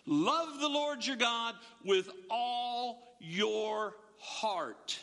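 very slow, drawn-out words, sinking at the end; solemn, measured scripture quote; slow, weighty emphasis. Acted. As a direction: stretch out each word of the commandment.

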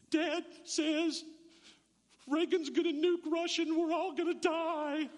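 put-on high, teary thirteen-year-old girl's voice; imitating a panicked young girl; scared girl. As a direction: mimic a frightened schoolgirl near tears. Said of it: "She goes".

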